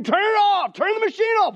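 high, loud shouted plea; panicked scream. Pretend. Bystander at a wood chipper, screaming for someone to stop the machine. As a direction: yell it as if someone is being pulled into a machine. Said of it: "would you not scream".

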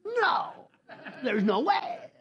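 high, mock disbelief with a laugh; mock incredulous denial. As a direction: scoff at the very idea. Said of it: "you would say".

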